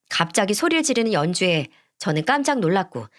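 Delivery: ordinary delivery, nothing marked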